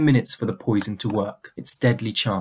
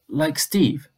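tentative question, lifted higher; half hopefully; 4 semitones higher than usual. A narrator's reading. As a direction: ask with a touch of hope. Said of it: "half hopefully".

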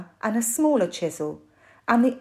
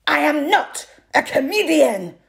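sharp, loud, higher snap; snapped; 7 semitones higher, 6 dB louder than usual. A narrator's reading. Strongwoman silences the laughing audience, loud angry snap. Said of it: "she snapped".

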